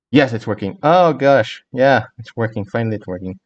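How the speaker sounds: usual speaking voice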